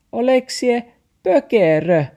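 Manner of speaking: high, loud dog voice; barking dog voice. Acted. The dog barks at Max, high and loud. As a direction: snap it out like a dog. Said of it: "taksi haukkui".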